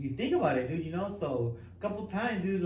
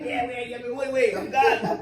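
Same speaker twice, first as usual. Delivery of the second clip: laughing; 8 semitones higher, 7 dB louder than usual. Retold or imitated. Cellmate leaving, laughing.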